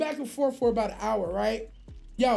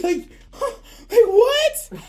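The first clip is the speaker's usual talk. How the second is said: disbelief; 8 semitones higher, 9 dB louder, much slower than usual. Retold or imitated.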